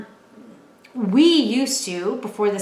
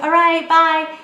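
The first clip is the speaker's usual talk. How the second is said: imitating the girl; 7 semitones higher, 7 dB louder, slower than usual. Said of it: "she's like".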